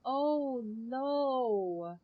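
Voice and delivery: normal talking voice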